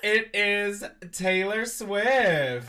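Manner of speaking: loud, slow showman reveal; grand announcement. Pretend. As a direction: announce it like a game show host. Announcing the celebrity guest.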